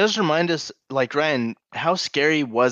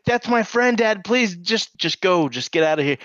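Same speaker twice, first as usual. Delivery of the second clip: pleading; 8 semitones higher than usual. Retold or imitated. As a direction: imitate a son begging his dad to back off. Pleading with enraged dad, retold.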